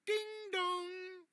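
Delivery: high sung ding dong like a bell; doorbell chime. Acted. Reader chiming the doorbell for children.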